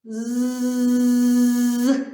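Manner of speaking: «З» as a long, loud voiced buzz. Shown as a demonstration. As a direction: buzz «З» hard so the throat hums. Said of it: "спробуємо сказати звук «з»". (гучне дзвінке «З»)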